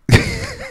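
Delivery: short high giggle; chuckle. Real, not acted. Storyteller amused at his own stupidity, chuckling. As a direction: let a brief laugh escape.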